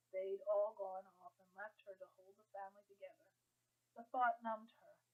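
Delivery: plain reading voice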